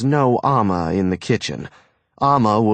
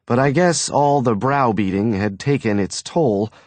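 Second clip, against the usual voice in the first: ordinary delivery, nothing marked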